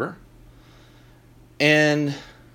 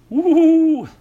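high, loud sarcastic woo; a big fake cheer; mock whoop; 8 dB louder than usual. Mock excitement over court cases.

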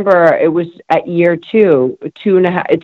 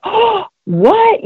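sharp gasp, then a very high, stunned "Oh, what?"; shocked gasp. Retold or imitated. Reacting to the saleswoman's insult, gasping high shock. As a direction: recoil as if slapped by the words. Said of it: "I just looked at her like".

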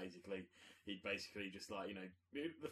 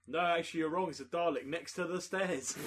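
mock gloating; 8 semitones higher, 8 dB louder than usual. Host gloating correction.

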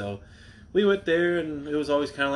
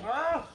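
startled yelp; 5 dB quieter than usual. Real, not acted. Startled by the dogs.

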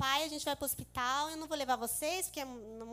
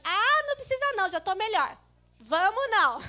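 husband brushing it off; 6 semitones higher, 9 dB louder than usual. Retold or imitated. Husband voiced in story.

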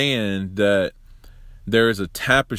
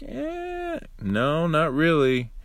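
dismissive judges; 7 semitones higher, slower than usual. Retold or imitated. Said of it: "because they're like".

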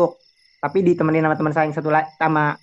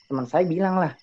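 ordinary talk, nothing marked

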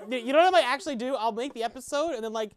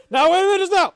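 high, very loud protest; mock outburst; 6 semitones higher, 8 dB louder than usual. Echoing a castmate's line, mock shouted protest. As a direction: yell it as a comic objection.

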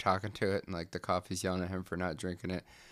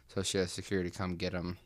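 ordinary talk, nothing marked

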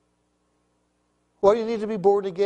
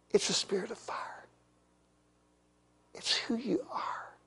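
whisper; 2 semitones higher, 12 dB quieter than usual. Real, not acted. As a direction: whisper it like a secret. Hushed sermon line.

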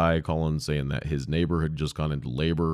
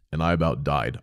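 ordinary talk, nothing marked

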